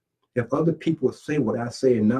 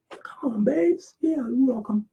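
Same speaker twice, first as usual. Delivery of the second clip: very high, softer mock woman's voice; welcoming girlfriend; 13 semitones higher, 3 dB quieter, slower than usual. Woman letting the freeloader stay, high mock-sweet welcome. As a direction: pitch way up as a girlfriend giving in.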